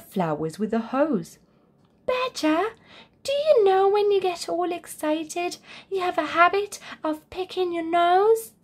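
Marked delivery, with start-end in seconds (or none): 2.0-8.5 high, gentle bear voice; a bear telling his friend kindly. A narrator's reading. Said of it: "Bo Bear said to Badger".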